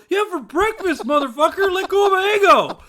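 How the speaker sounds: high-pitched acted indignation; indignant retort. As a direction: fire back in mock outrage. Indignant retort after attempted kiss.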